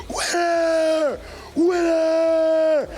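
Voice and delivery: high, loud triumphant shout of winner; victory shout. Retold or imitated. Fighter yells at his beaten rival, loud triumphant shout. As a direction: yell it right in the beaten rival's face. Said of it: "looked him in the face and said".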